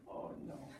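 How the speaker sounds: whispered oh no; hushed, voiceless dismay. Acted. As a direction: breathe it out as a whisper. Same words whispered after.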